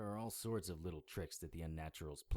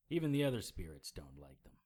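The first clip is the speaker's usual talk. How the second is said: ordinary delivery, nothing marked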